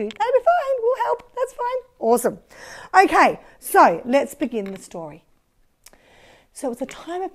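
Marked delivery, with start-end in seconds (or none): 0.0-2.0 high, squeaky little helper; high toy voice. Pretend. Another toy disciple answers.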